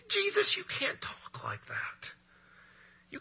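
urgent high whisper; a whispered scolding aside. Acted. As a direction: hiss it like a warning behind his back. Scribes warning Jesus privately.

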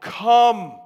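loud, high, drawn-out shout; one long ringing call, falling at the end; crying out. Acted. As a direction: boom it out as a commanding summons. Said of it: "they cry out".

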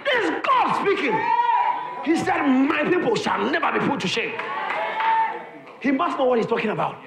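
high, loud revival preaching; fiery preaching. Acted. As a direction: shout the promise over the congregation. Preaching at full force.

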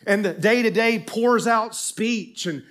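ordinary talk

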